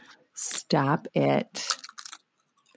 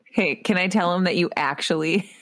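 ordinary talk, nothing marked

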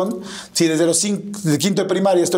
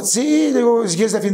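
eager yes; 7 semitones higher than usual. Retold or imitated.

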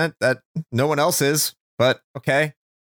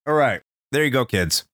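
usual speaking voice

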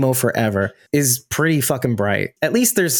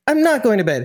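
high-pitched imitation of a stubborn child; defiant kid; 8 semitones higher than usual. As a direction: refuse bedtime, cartoon-argument style. Retold kid-versus-dad bedtime standoff.

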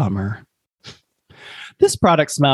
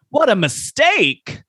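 dramatic lament; 11 semitones higher, slower than usual. Acted. Dramatic review reading.

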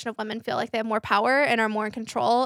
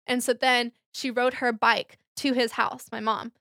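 usual speaking voice

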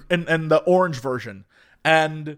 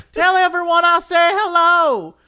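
put-on high, drawn-out Southern old lady; 12 semitones higher, 3 dB louder than usual. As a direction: holler it slowly in an elderly Southern drawl. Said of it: "old Southern lady who's just".

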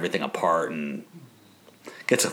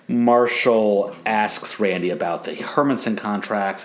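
ordinary talk, nothing marked

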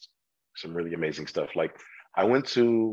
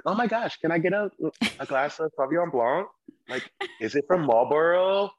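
much higher, gushing soccer mom voice; 9 semitones higher than usual. Retold or imitated. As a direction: play the excited soccer mom ordering wine. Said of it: "the soccer moms would come in like".

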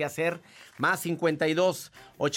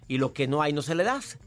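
ordinary delivery

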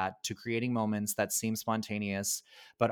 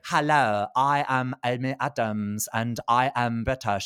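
mock British accent, louder opening; terrible British accent; 5 dB louder than usual. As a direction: do a deliberately bad, affected British accent. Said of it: "how terrible Amy Adams is British".

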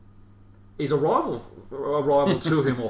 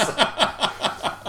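real laugh; 11 semitones higher, 4 dB louder than usual. Real, not acted. Embarrassed laugh at the story.

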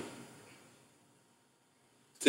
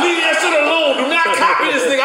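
high-pitched, urgent mock warning; emphatic warning; 9 semitones higher, faster than usual. Friends telling him not to copy, high urgent warning. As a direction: shout him off the idea. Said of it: "they fully warning me".

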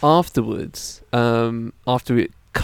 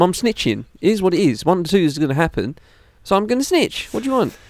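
high-pitched mock-indignant tirade; comic defiant rant; 7 semitones higher than usual. Defending his snitching to his friend, high and mock-furious. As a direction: keep the outraged bit going, high.

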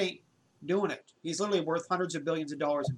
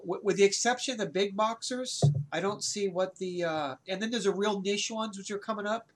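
ordinary delivery

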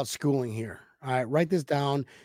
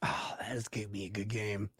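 hushed unvoiced aside; whispering; 6 semitones lower, 15 dB quieter than usual. Real, not acted. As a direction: whisper it like a secret. Aside about the matchup.

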